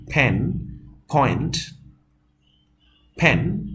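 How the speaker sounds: aspirated p opening "ফ্যান" and "পয়েন্ট"; model pronunciation. Shown as a demonstration. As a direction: puff air on the first sound of "ফ্যান", "পয়েন্ট". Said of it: "যেমন দেখো".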